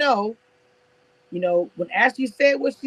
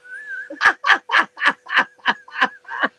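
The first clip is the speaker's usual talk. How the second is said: a high, airless wheezing laugh; breathless wheeze laugh; 7 semitones higher than usual. Real, not acted. Vlogger wheezing at her friend's live video, silent laughter. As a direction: laugh so hard no voice comes out.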